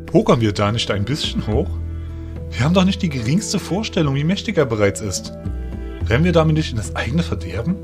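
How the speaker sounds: questioning figure voice full of doubt; doubtful. A narrator's reading. Ferry doubting the plan to wake the enemy, doubtful. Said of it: "Hakte Ferry zweifelnd nach".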